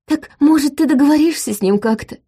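high, begging question; pleading. A narrator's reading. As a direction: plead with her desperately. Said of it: "умоляюще сложил на груди руки".

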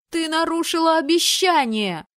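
high, loud betrayed cry; angry shout. Acted. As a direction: yell it at her.

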